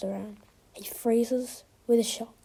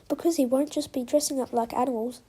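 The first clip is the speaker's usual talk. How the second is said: usual speaking voice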